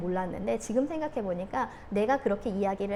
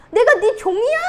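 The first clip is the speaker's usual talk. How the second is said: high, loud exasperated yell; retold blow-up; 11 semitones higher, 12 dB louder than usual. Mom to crying child, retold exasperated yell. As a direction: act out snapping at a crying kid. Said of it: "터졌을 때 어떻게 터졌냐면".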